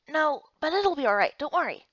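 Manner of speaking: usual speaking voice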